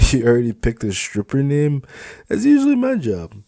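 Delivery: high, loud, teasing jab; gleeful high mockery; mocking supervisor. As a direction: laugh at her from above. Felix mocks her name.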